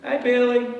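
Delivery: high, loud Mississippi "Hey, Billy" with a long drawn "Billy"; Deborah's Mississippi hello, high and loud; Mississippi drawl. Retold or imitated. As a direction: greet him like a bubbly Mississippi wife. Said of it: "You know, Mississippi".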